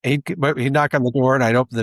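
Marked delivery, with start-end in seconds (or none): none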